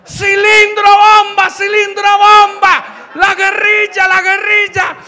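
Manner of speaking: high, panicked mock scream; loud, drawn-out high cries, hammed up; grandmother screaming. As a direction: shriek the alarm like a terrified granny. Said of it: "mi abuela desde el tercer piso gritó".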